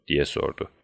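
ordinary delivery

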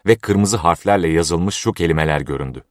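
ordinary delivery

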